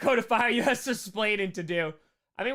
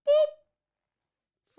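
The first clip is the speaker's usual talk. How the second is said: a squeaky high-pitched boop; 5 dB louder than usual. Acted. Donation boop sound effect.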